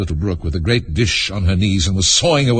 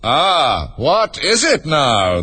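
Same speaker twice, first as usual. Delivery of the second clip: higher, drawn-out eager cry; eager unicorn, slow and raised; 7 semitones higher, much slower than usual. A narrator's reading. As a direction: relish the question, stretching it eagerly. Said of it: "the unicorn cried eagerly".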